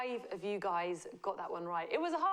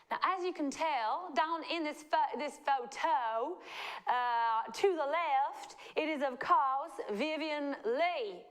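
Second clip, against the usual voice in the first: higher, hammed-up Southern belle accent; Southern American accent; 3 semitones higher than usual. Host teasing the quiz question, Southern American accent. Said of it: "I was a Southern American accent".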